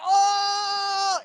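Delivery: high mock shout of a crowd; playful crowd oh; group reaction shout. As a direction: call out the gasp like the whole room. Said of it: "everyone's like".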